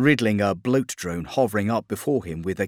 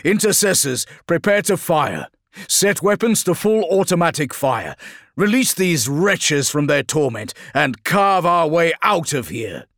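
high, loud commander's orders; rally the squad with raised voice; forceful battlefield command, easing at the very end; ordering; 8 semitones higher, 5 dB louder than usual. Acted. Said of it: "Felix ordered".

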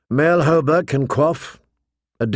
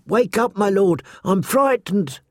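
high-pitched, hushed plea; frightened whisper; 6 semitones higher than usual. A narrator's reading. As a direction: plead as if waking someone in fear. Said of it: "she whispered".

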